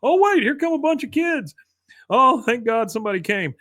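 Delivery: very high, wailing imitation; mock crying. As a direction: whine it out like a blubbering man on the radio. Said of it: "you just hear this crying".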